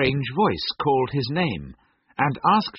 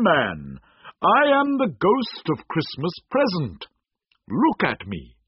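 cheerful spirit; 6 semitones higher, 3 dB louder than usual. A narrator's reading. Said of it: "called out cheerfully".

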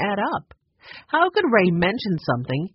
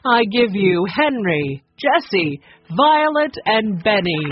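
louder, slow stage announcement; announcing; 4 semitones higher, 5 dB louder, slower than usual. A narrator's reading. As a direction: present them to the crowd. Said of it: "Fern announced".

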